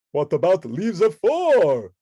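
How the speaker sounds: loud, high, hammed-up count. The Count asking Bert about four leaves, loud. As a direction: ask with theatrical relish.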